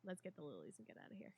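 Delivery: hushed urgent whisper. Retold or imitated. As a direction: whisper it fast and low. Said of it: "I'm like".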